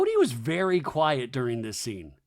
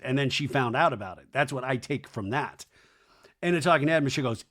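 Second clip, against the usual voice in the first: ordinary delivery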